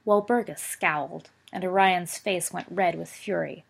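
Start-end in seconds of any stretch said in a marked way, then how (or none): none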